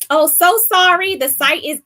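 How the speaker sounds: sudden high, loud "oh"; surprised. Real, not acted. Suddenly remembers a correction.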